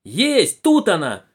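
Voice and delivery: high, loud shout of discovery; sudden cry. A narrator's reading. Apprentice announces the viper is found, crying out. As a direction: cry out on spotting it. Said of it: "вскрикнул вдруг один из ремесленников".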